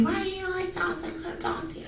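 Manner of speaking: high, soft sweet lady voice; lightly mocking high, soft coworker.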